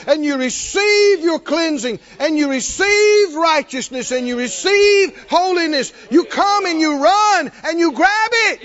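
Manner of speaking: high, loud, driving preacher shout; preaching at full force. Real, not acted.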